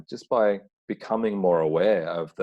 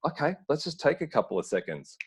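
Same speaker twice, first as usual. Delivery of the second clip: light, much higher voice for the partner; a lightly voiced, soothing partner; voicing partner; 9 semitones higher than usual. Partner's reply voiced in story.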